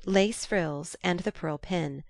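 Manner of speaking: ordinary delivery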